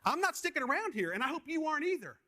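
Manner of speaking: cheeky defiant farewell. Retold or imitated. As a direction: gleefully taunt him like the Roadrunner escaping. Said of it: "here's my last words to Satan".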